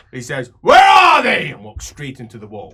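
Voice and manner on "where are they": loud, high bellow; dwarf shout. Acted. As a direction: demand it at full voice. Drunk dwarf demanding.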